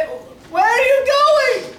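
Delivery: calling out. Real, not acted. Calling after someone leaving.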